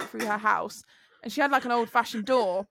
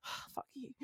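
whispered curse; muttered curse; 21 dB quieter than usual. Retold or imitated. Teen cursing the ambulance driver, whispered. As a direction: whisper the curse under your breath. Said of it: "I'm like".

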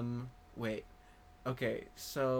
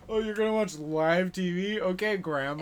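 mocking taunt; 4 semitones higher, 6 dB louder than usual. Mock taunt to old viewer.

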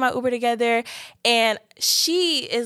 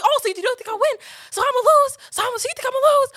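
offended high yell; 5 dB louder, faster than usual. Retold or imitated. Offended, challenging boyfriend.

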